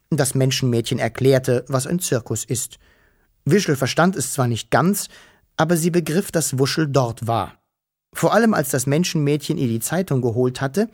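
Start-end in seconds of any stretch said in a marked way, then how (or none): none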